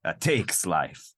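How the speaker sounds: broad, hammed-up Italian accent. Bossing her around with a knife, mock Italian accent. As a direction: play a boastful Italian seducer.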